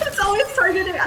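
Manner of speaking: high, amazed disbelief; surprised. Real, not acted. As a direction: caught off guard, voice jumping up. Caster shocked by Puzzle Box.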